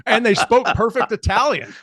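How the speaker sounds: high, loud punchline; amazed exclamation. Real, not acted. Storyteller hitting the chefs punchline, amazed exclamation. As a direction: blurt it out loudly between laughs.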